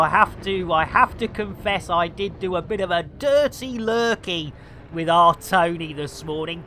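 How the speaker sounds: ordinary talk, nothing marked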